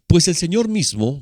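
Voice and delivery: reading the verse out loud, with weight; booming preacher reading scripture. Acted. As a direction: proclaim the verse to the church.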